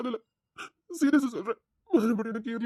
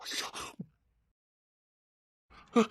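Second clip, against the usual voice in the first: usual speaking voice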